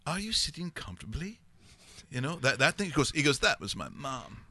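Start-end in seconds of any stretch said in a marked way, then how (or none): 0.1-1.4 high, soft mock-British singer voice. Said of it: "I don't know why I'm doing that voice". 3.4-4.4 slow, softer mock-British drawl. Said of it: "I don't know why I'm doing that voice".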